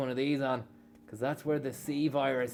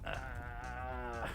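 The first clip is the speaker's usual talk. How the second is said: ordinary talk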